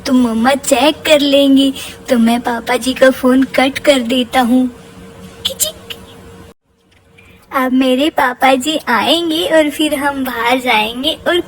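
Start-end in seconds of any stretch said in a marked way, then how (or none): 5.5-5.8 high clicking noise; click sound. Pretend. Cutting the call to papa, high mouth click. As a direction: make a quick phone-click sound. Imitating phone cut sound.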